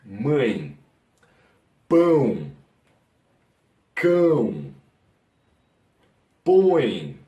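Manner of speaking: "mãe", "pão", "cão", "põe" each with a nasal diphthong, spaced out; Portuguese nasals. Shown as a demonstration. Teacher giving students nasal examples, careful demo. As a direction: say each word with its nasal vowel, one at a time. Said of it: "les voy a dar más ejemplos".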